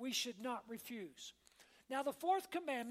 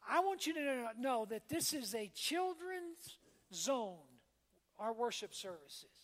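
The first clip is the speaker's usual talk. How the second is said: ordinary delivery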